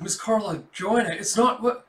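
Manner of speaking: high, loud, frantic excuse; frantic pleading. Acted. Governor pleading, cut off.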